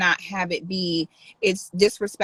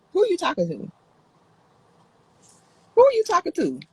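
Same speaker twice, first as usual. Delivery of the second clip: higher and sharper on the repeat; indignant challenge; 10 semitones higher, faster than usual. Real, not acted. Streamer challenging a rude commenter, indignant. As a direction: call out the commenter with attitude.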